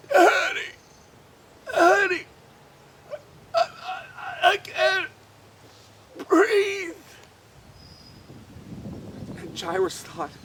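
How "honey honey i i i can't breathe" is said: high, tearful pleading; a sick child gasping for help; frightened, struggling to breathe. A narrator's reading. Sick girl calling her father, high and tearful. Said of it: "Becky is going".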